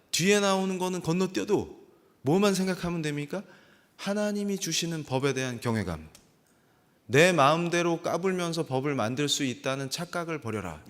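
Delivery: usual speaking voice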